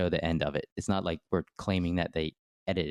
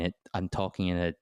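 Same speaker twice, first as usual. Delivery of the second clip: ordinary delivery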